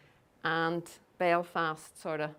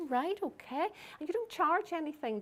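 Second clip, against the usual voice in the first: lightly voiced higher-pitched caller; a hint of a doubtful organiser, pitched up; wary organiser; 9 semitones higher than usual. Group organiser asking about free talk, wary.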